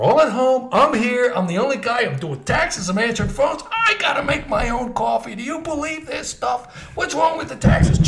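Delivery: high-pitched comic complaint; Larry David. Retold or imitated. Overworked accountant griping to caller, high Larry David impression. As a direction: rant in a high, exasperated impression. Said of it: "just like in Larry David's voice".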